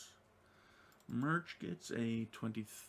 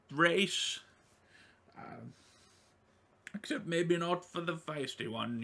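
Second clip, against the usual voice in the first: higher, louder jovial host; high NPC voice; 7 semitones higher, 3 dB louder than usual. Acted. NPC invites the party for drinks, high and hearty. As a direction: welcome them like an eager host.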